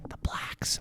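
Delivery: secretive whispered reply. Retold or imitated. Comic imitating the woman's hushed answer, whispered. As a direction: breathe it out like a secret.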